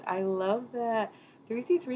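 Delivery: pleased, emphatic gush; delighted. Real, not acted. Delighted by repeated numbers.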